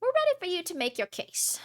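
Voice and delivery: a hint of a stern elderly superintendent; old person voice. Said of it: "so I do my old person voice".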